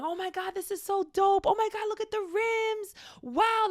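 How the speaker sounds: high-pitched, loud gushing; excited friend. Acted. Happy friend admiring the new car, excited. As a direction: hype it up, squealing with delight. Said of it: "they're, like, excited".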